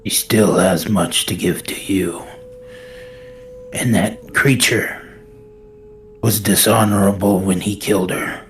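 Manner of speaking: forceful plea. Acted. Pleading with the statue god.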